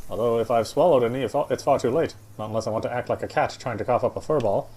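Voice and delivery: usual speaking voice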